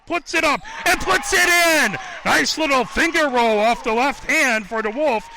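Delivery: loud, high burst of play-by-play excitement; excited basket call. Real, not acted. Commentator celebrates a finger roll going in, excited. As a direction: call the score with a real surge.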